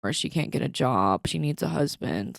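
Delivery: usual speaking voice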